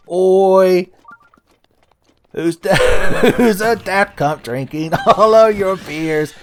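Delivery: loud, high, hammed-up Australian yell, breaking into laughter; terrible Australian accent. Gamer playing an angry beer drinker, terrible Australian accent. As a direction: bellow it in a bad Australian accent, cracking up. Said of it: "terrible Australian accent".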